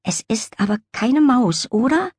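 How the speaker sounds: mildly worried question, lifted at the end. A narrator's reading. Lilli checks with the cat it's no mouse, mildly worried. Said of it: "fragte die Katze besorgt".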